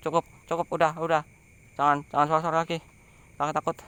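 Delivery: loud, frightened begging to stop; scared pleading. Real, not acted. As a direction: plead with the voices in fear. Begging spirit voices to stop.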